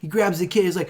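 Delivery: ordinary delivery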